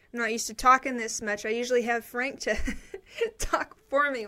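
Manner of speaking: breathless laugh while talking. Real, not acted.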